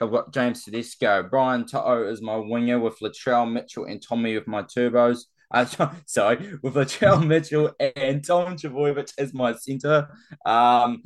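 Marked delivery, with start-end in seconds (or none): 5.5-11.0 laughing through a slip-up; giggling while correcting himself. Real, not acted.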